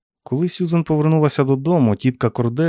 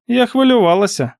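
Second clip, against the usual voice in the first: high, louder aunt, not truly worried; unconvincing concern; 11 semitones higher, 5 dB louder than usual. A narrator's reading. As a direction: claim worry without really sounding worried. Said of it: "її голос звучав не зовсім стурбовано". (високо, з нещирою стурбованістю)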